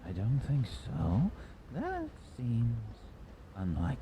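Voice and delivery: muffled voice. Acted. Game master voices a stranger heard by the injured man, muffled. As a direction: speak as if heard dimly through a haze. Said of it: "You can hear some muffled voice".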